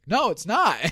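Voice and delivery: high-pitched, eager retort; retold excited contradiction; acted emphatic denial. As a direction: call out the wrong card excitedly. Acted reply to wrong card.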